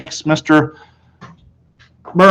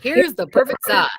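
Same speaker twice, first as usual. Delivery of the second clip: salesman coaxing; 5 semitones higher than usual. A narrator's reading. Salesman coaxing Fudge to try shoes, slow. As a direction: coax the toddler gently.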